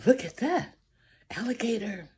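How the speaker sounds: ordinary delivery, nothing marked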